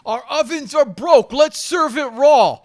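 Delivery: high, loud joking chef voice; hammed-up chef excuse; mock chef. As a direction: announce it like the restaurant owner.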